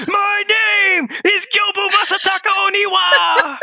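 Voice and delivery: mock the bad English dub with a huge yell; over-the-top warlord bellowing his own name; bad dub shout. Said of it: "the English voice acting is so bad".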